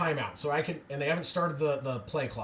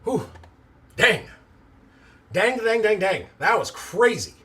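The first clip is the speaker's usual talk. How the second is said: loud, higher repeated dang in disbelief; stunned excitement; 7 semitones higher, 8 dB louder than usual. Real, not acted. Fan blown away by the return, loud stunned dangs. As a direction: let the disbelief burst out.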